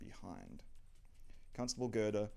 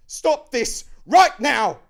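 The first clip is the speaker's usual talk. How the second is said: high, very loud, drawn-out order; full-force furious commanding scream; 22 dB louder, slower than usual. Acted. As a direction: scream over the din. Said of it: "tries to scream over him".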